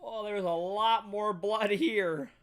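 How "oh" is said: ordinary delivery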